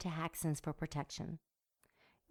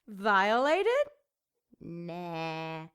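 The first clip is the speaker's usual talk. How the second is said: mock disbelief; 6 dB louder, much slower than usual. A narrator's reading.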